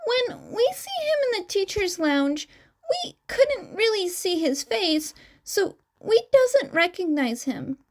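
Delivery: high twin voice. Acted. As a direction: chirp the lines up high like the young twin.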